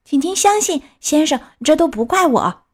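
high-pitched anxious plea; pleading little puppet. A narrator's reading. Pinocchio excuses himself to the boss, high pleading. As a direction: plead your innocence in a high voice.

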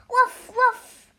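high, punchy woofs; two sharp barks from a storybook dog; dog barking. A narrator's reading. Dog waking the farm in a story, high loud barking. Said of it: "said dog".

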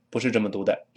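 normal talking voice

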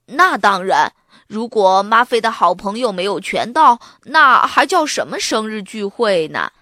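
put-on loud boy character voice; 男孩肯定地回答. A narrator's reading. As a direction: answer confidently and loudly. Boy confidently answers his friend. (男孩理直气壮地大声说)

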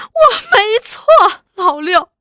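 high, loud, tearful cry of innocence; a mild hint of sobbing through it; crying protest. Wife crying out her innocence to Laoliu as he strikes. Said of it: "预算只是哭".

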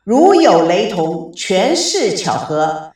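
stately slow broadcast outro; radio host sign-off. Acted. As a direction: draw out the closing disclaimer for the audience. Closing announcement to listeners.

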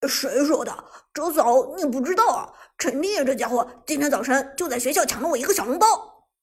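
loud, lively protest; indignant complaint. Acted. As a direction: deny it loudly and complain with feeling. Denies it, complains about stolen bun.

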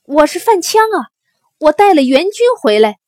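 high, loud, fast shout; hurried shout announcing rescue; urgent calling out. A narrator's reading. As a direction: call out to those inside the city walls. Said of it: "范羌赶紧在城外喊".

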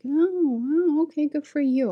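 high mock-impressed tone; condescending. Coworker bringing the teacher down, mock condescension. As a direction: imitate someone belittling with fake praise. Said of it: "trying to bring you down".

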